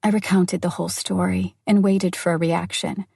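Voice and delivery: plain reading voice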